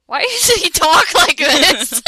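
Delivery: loud, laughing disbelief; laughing shout. Real, not acted. Friend laughing at absurd fanfic dialogue, loud laughing shout. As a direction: crack up while asking.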